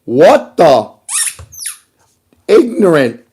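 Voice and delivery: loud, high mock-angry ranting; hammed-up furious read of a nasty text; loud, slow mock fury; reading an angry message. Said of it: "read to you what this individual said".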